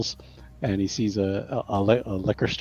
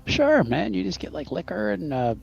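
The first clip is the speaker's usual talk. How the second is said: breezy NPC voice; 7 semitones higher than usual. Acted. NPC casually explains Molotovs.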